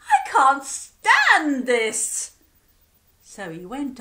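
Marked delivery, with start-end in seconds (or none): 0.0-2.3 loud, slow and fed-up; fed-up bear. Acted. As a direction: drag out every word in frustration. Sleepless bear exasperated.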